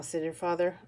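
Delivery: plain reading voice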